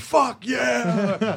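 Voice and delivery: high, exultant shouted cheer; retold scream. New correspondent celebrating getting the job, screamed in retelling. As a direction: shout it up high, reliving the win. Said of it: "I think I screamed".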